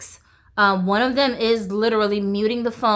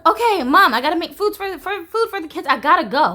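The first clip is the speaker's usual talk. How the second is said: fake excuse to mom; 8 semitones higher, 4 dB louder, faster than usual. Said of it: "I'll be like".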